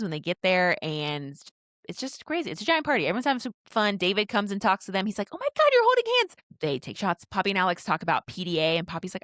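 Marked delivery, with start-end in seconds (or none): 5.3-6.4 fast, high squeal of delighted teasing; squealing tease. Retold or imitated. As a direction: gush it like a giddy friend. Friend teases the couple.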